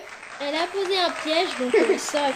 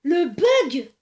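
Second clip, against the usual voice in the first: surprised; 7 dB louder, much slower than usual. Real, not acted. Glitch in the game.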